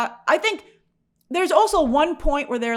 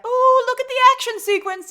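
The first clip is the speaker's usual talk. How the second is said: mock excitement; 13 semitones higher, 4 dB louder than usual. Mock viewer reaction.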